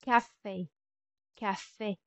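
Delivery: "café" ending on a closed é as in "day"; French pronunciation. Shown as a demonstration. Teacher giving an accent aigu example, French pronunciation. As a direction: model "café" with a clear, short final é. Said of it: "Next will be".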